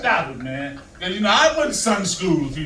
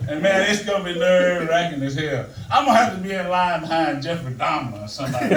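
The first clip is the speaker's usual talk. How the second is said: normal talking voice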